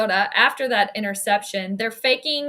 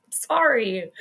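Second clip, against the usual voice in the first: ordinary talk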